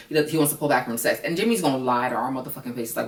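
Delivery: normal talking voice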